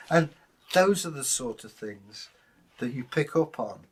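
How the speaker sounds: ordinary talk, nothing marked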